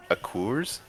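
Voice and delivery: ordinary delivery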